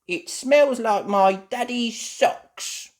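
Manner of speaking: high, loud, slow complaint; disgusted Pedro. Acted. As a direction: wrinkle your nose.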